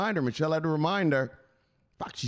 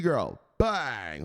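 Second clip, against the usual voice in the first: normal talking voice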